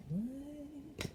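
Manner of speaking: mock disbelief. Real, not acted.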